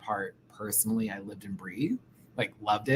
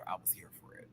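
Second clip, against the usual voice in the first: whispering; 5 semitones lower, 20 dB quieter, faster than usual. Real, not acted. Whispered aside.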